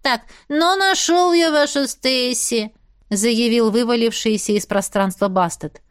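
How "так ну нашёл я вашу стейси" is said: higher, drawn-out cat voice; cat character. Acted. Bast reporting he found Stacy, casual cat voice. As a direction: play the cat, slow and high.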